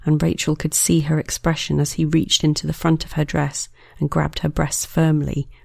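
plain reading voice

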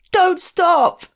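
high, loud anguished cry; a frantic partner yelling don't stop; desperate plea. Acted. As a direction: beg as if it hurts to wait. Lover begging desperately.

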